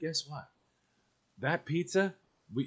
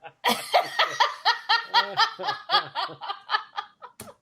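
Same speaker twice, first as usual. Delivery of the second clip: long rising high-pitched laugh. Real, not acted. Laughing at his own neighbour joke, long and high. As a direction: let a high laugh climb and ring out.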